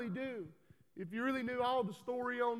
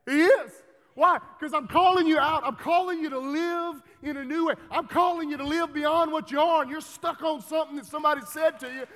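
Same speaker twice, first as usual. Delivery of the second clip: loud, high fire-and-brimstone shout; preacher shouting at a complacent congregation; fiery preaching; 6 semitones higher, 12 dB louder than usual. Real, not acted. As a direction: call them out at full force.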